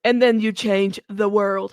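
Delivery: loud, mannish founder parody; Elizabeth Holmes voice. Acted. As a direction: belt out a put-on baritone CEO voice. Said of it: "I could do the voice".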